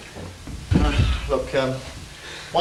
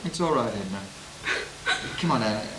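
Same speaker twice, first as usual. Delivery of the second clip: soft soothing; 6 semitones lower, 8 dB quieter than usual. Acted. Husband soothing distraught wife.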